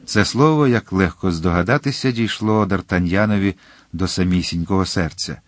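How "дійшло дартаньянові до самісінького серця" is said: plain reading voice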